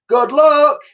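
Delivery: high, loud farewell; shout. Acted. Gang shouting goodbye to Elsie, loud farewell. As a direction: shout goodbye across the water. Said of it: "they shouted".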